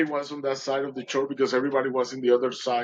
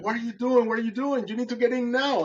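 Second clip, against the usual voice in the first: much higher, insistent urging; urgent retold command; 10 semitones higher than usual. Boatman to exhausted swimmer at the boat, urgent. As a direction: hurry him aboard, voice pitched right up.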